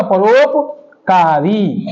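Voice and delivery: परोपकारी split as परोप, pause, कारी; drawn-out परोपकारी for the class; slow dictation in parts. Shown as a demonstration. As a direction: say परोपकारी slowly in two halves. Repeats answer in parts for class.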